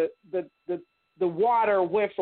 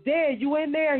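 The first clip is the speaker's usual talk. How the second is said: put-on higher-pitched young brother calling through the door; 8 semitones higher than usual. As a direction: call out to Dad from the hallway as a boy. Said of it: "He goes".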